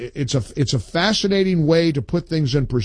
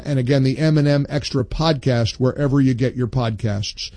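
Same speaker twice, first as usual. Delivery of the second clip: ordinary delivery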